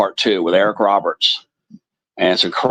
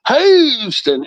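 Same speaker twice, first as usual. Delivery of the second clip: high mock country drawl on Houston; imitated movie accent; 3 dB louder than usual. Telling viewers the movie punchline, high mock country accent. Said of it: "that's why I always say it like that".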